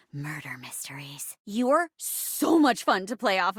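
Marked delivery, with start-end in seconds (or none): 0.1-1.4 low, whispery, ominous genre reveal; mysterious hush. Acted. Host names the mystery genre.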